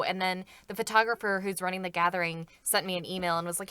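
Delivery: usual speaking voice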